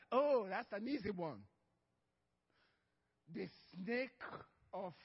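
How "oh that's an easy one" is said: ordinary talk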